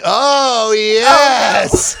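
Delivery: high breathy oh yes; sultry oh yes; imitating Kelly. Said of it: "to quote Kelly".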